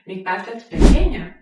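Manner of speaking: pequeña called out loudly; loud model read. Shown as a demonstration. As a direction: announce "pequeña" clearly and loud. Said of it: "teacher repeats model phrase".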